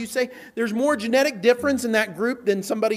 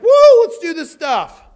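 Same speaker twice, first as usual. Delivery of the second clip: loud, higher whooping newcomer; mock excitement; 6 semitones higher, 11 dB louder than usual. Preacher imitating an excited churchgoer, loud whoop. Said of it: "they get all excited".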